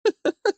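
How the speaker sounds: a quick high burst of laughter; bright sudden laugh; short laugh. Real, not acted. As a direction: laugh it off at the mix-up. Laughs at her pronunciation.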